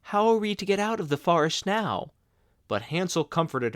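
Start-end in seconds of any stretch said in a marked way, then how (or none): 0.1-2.1 crying. A narrator's reading. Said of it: "Gretel began to cry".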